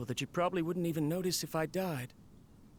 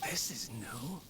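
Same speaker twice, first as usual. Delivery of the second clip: softer, voiceless, to himself; hushed whisper; 5 semitones lower, 6 dB quieter, slower than usual. Acted. Inventor surprised by his robot's change, whispering to himself. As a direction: whisper it like a discovery you don't want to disturb. Inventor noticing something strange.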